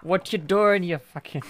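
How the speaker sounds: loud, high mock-Irish outburst. Mimicking an annoyed friend turning Irish, loud Irish accent. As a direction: imitate an annoyed Irishman raising his voice. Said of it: "he'll raise his voice".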